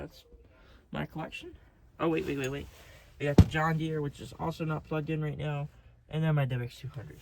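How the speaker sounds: usual speaking voice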